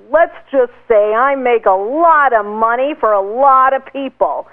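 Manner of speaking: bragging tone. Retold or imitated. A bragging guy at a networking party, smug boast. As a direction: lean back and show off the money. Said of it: "he had a very bragging tone".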